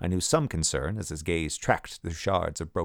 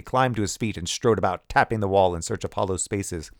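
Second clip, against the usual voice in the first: ordinary delivery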